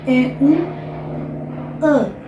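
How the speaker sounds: very slow nasal A, pushed into the nose. Shown as a demonstration. Teacher showing the nasal A slowly. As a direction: send the air into the nose. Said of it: "jogar o seu ar bem no nariz".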